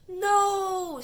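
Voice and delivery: high, loud cry of dismay; anguished no. Acted. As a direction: cry out as the caterpillar crawls toward danger. Caterpillar heads toward steamrollers.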